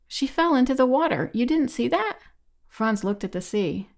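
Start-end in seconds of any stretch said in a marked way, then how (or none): 0.1-2.2 higher, urgent voice; alarmed disbelief. A narrator's reading. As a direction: press them in rising alarm. Alarm as friend goes under.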